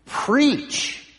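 "preach" pitched very high and set apart; stress on preach. Shown as a demonstration. Preacher telling the congregation their calling, high stress on "preach". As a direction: punch "preach" high, then let it fall. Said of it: "Let's emphasize that first word".

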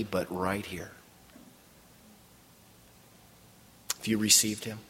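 low, soft, searching questions; gentle earnest appeal. Real, not acted. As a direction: ease down into a hushed personal invitation. Preacher's soft personal altar appeal.

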